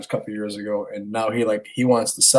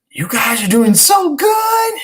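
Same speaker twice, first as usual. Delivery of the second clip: put-on high, drawn-out cheer for the kids; exaggerated delighted praise, slow and high; gushing praise; 4 dB louder, slower than usual. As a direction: sing out proud parental encouragement. Dad cheering on his children.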